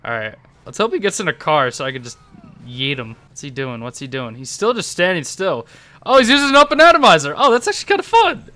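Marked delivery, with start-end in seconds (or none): 6.0-8.6 high, loud, delighted outburst; excited surprise. Real, not acted. Player spots rival's silly weapon, excited surprise. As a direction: burst out high and quick, tickled by it.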